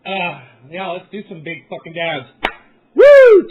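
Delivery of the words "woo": very high, loud whoop. Real, not acted. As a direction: let out a wild party whoop. Cheering for the dabs.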